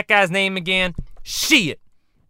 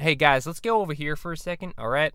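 normal talking voice

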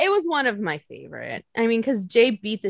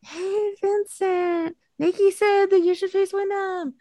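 higher, put-on sweet fan voice; a cheeky fan passing on a message; mock fan voice; 8 semitones higher, slower than usual. To fans, a higher, teasing fan voice. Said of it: "And then be like".

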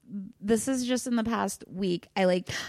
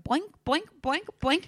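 bouncy boing noises, the middle ones pitched higher; cartoon boinks; 8 semitones higher than usual. Telling her cohost about her cartoon visualization, bouncy cartoon boinks.

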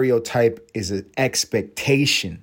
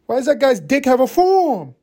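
high, incredulous question; high-pitched comic bewilderment; mock dismay; 14 semitones higher, 4 dB louder than usual. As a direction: act a baffled viewer's protest. Comic keeps acting shocked viewer.

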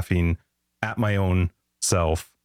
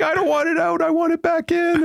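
put-on high wailing child's voice, much higher and louder; mock wail of a little girl; imitating a crying child; 4 dB louder than usual. Dad imitating his crying daughter, high wail. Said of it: "she started to cry".

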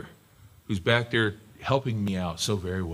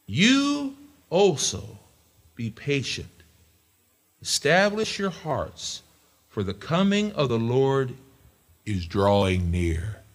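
slow, high, drawn-out reading; scripture reading; 3 semitones higher, slower than usual. Shown as a demonstration. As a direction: read it like a promise. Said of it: "read that one more time".

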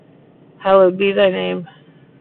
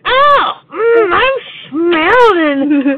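a slow, high, hammed-up nerd voice; Sheldon impression; 8 semitones higher, much slower than usual. Kid doing a bad Sheldon on request, slow and high. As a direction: drag it out like a goofy nerd. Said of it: "give me a character".

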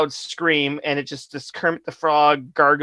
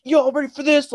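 imitating a hype call, pitched up; mock hype man; 11 semitones higher than usual. Mocking a promoter hyping an empty crowd, pitched up. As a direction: pump up an empty room. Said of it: "He's like".

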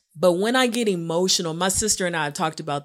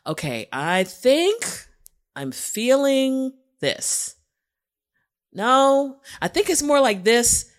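searching for words; 3 semitones higher, 3 dB louder than usual. Retold or imitated. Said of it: "here we go".

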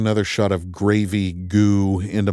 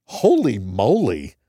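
puzzled echo; 3 semitones higher, slower than usual. Real, not acted. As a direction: echo "Holy moly" in disbelief, drawn out. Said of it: "Is that my go-to expression".

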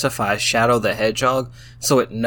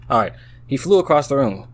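normal talking voice

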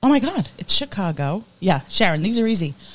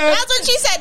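much higher put-on voice; high squeaky repeat. Co-host repeats the joke in a much higher put-on voice. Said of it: "Make him talk".

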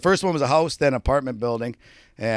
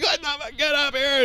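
panicked call; 14 semitones higher than usual. Retold or imitated. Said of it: "you're like".

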